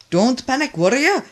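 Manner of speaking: loud, high urgent shout. A narrator's reading. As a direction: shout to steady him. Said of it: "shouted O J".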